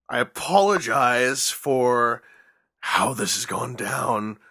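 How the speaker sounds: slow, laboured mock apology; strained apology. Host apologizing to listeners for his co-host, slow and pained. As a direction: drag it out as if it hurts to say. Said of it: "That actually kinda hurt my throat to say".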